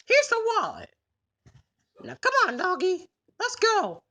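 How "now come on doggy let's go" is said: ordinary delivery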